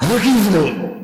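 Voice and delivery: forceful refusal. Retold or imitated. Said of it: "اتنے زور سے کہا".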